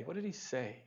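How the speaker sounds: hushed repeat. Real, not acted. Pastor quietly repeating his question to the congregation. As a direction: drop to a near-whisper on the repeat.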